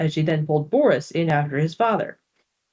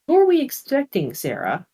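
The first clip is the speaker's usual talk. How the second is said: sister's voice; 7 semitones higher than usual. Acted. Rachel asks, voiced higher.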